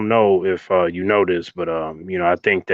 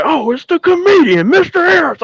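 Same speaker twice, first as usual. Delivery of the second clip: high, loud, sarcastic sergeant taunt; imitated sergeants mocking the laughing kid; mocking drill sergeants; 5 dB louder than usual. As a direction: sneer it like sergeants calling out the class clown. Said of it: "they was just like".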